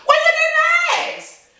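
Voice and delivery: drawn-out high retort; sing-song retort. Acted. A host teasing a shocked friend, high and drawn out. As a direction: stretch it out, teasing.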